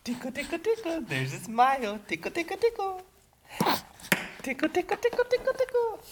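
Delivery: put-on very high, soft baby talk; giggly tickle attack. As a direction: coo softly up high at the baby. Tickling baby to make her laugh.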